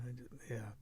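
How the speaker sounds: choked-up whisper; barely voiced, moved. Real, not acted. Moved by the scene.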